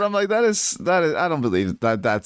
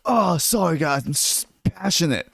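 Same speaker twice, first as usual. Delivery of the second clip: mock passionate outburst; 7 semitones higher than usual. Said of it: "slamming his fist".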